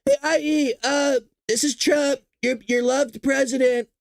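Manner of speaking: high, slow, sheepish Trump voice; mock Trump voicemail. Trump leaving an awkward voicemail for Iran, high sheepish mock. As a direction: play it innocent and awkward. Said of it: "He just go".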